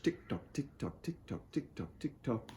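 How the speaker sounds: very soft, evenly repeated ticks; ticking watch. Acted. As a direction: tick quietly like a pocket watch. Imitating the pocket watch ticking.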